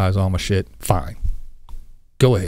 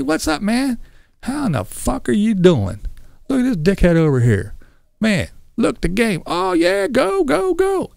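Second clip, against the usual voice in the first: hyped-up bar buddy, pitched higher; rowdy buddy talk; 11 semitones higher than usual. Pretend. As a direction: loosen up and rib your friend like at the bar.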